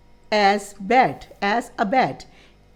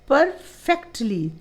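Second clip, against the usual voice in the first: slow syllable count; 3 semitones higher, much slower than usual. Shown as a demonstration. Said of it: "three syllable".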